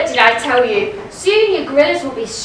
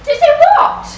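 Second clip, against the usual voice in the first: much higher, louder startled ask; startled squeak of a question; surprised question; 13 semitones higher, 5 dB louder than usual. Acted. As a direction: pop up with surprise. Marian surprised by warning.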